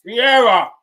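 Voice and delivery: high, loud yelling at the screen; frantic shouting; loud urging. Real, not acted. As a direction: scream at the striker to score. Urging team to score.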